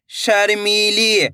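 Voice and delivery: शर्मीली drawn out slowly; slow model word. Shown as a demonstration. Teacher repeating the word slowly. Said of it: "एक बार फिर से देखिए".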